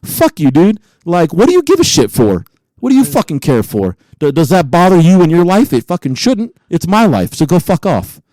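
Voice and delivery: hammed-up furious outburst at a listener; mock angry rant. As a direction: tell them off, half joking but heated. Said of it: "it came out super shitty. I'm like".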